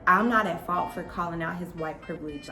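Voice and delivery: ordinary talk, nothing marked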